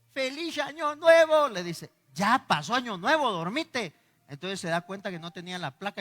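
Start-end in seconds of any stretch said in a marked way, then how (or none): 0.1-1.5 put-on high, slow wavering old man's cry; quavering old man. Frail old man calling out his New Year greeting, high and slow. 2.1-3.9 loud scolding wife. Retold or imitated. Old wife snapping back.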